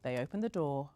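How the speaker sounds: ordinary delivery, nothing marked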